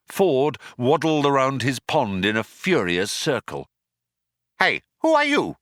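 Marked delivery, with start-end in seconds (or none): 4.6-5.6 high penguin squawk; high, ducky character voice; quacked. A narrator's reading. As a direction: quack it indignantly, high-pitched. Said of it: "he quacked".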